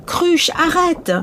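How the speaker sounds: slow, high desperate plea; desperate cry. A narrator's reading. Mother panics.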